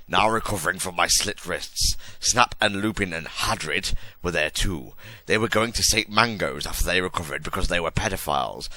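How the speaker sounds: usual speaking voice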